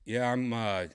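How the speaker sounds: ordinary delivery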